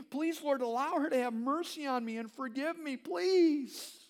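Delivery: high, loud pleading prayer; a pastor retelling his desperate prayer over his marriage, loud and high; crying out to God. Retold or imitated. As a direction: beg the Lord, high and loud.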